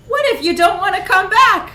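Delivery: gleefully sinister brochure tease; mock pamphlet hype. A narrator's reading. Narrator reading the pamphlet's creepy question as a cheery ad. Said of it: "pamphlets ... that shouted".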